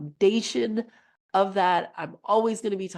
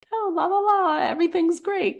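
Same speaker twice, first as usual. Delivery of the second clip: much higher, sing-song "Oh, la, la, la" and a louder "everything's great"; mock airy denial; 11 semitones higher, 4 dB louder than usual. Coach mocking spiritual bypassing, airy sing-song. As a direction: play the naive toxic-positivity type, breezy and high. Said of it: "than like".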